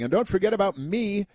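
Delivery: high-pitched imitation of a shameless plugger; mock self-promoter. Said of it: "spend their whole life self-promoting".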